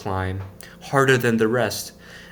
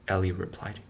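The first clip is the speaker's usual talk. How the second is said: ordinary delivery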